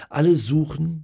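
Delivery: plain reading voice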